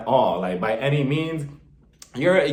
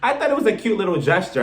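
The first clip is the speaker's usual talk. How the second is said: normal talking voice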